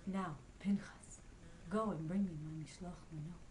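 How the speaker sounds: a hint of a quietly instructing rabbi; rabbi's instruction. A narrator's reading.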